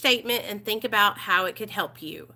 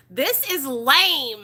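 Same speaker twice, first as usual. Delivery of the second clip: very slow, louder and higher, stretched out; scornful mock verdict; 4 semitones higher, 5 dB louder, much slower than usual. Reader reacting to lame workbook advice, drawn-out mockery. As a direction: drag out the last word in amused disgust. Mocking the workbook, breaking into laughter.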